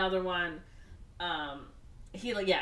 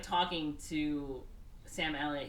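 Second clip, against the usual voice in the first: usual speaking voice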